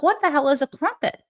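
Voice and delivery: baffled. Retold or imitated. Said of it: "And I'm like".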